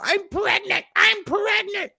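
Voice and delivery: mock shrill high-pitched "I'm pregnant"; hammed-up high imitation of a fake pregnancy claim. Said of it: "She's like".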